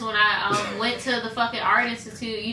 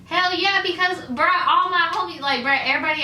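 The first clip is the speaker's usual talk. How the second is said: excited; 8 semitones higher, 4 dB louder than usual. Real, not acted. Excited about Wendy's job.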